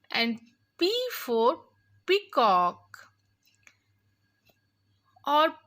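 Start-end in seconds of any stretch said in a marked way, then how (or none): none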